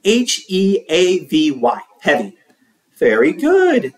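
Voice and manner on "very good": high teacher praise. A narrator's reading.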